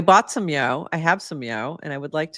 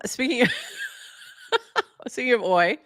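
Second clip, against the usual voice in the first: laughing; 7 semitones higher than usual. Real, not acted. Laughing announcing new frame.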